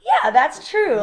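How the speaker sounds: high, loud laughing "yeah that's true"; imitating her own amused agreement; amused realization. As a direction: concede it with a laugh. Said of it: "And I'm like".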